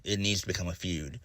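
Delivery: ordinary talk, nothing marked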